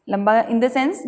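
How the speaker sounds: ordinary talk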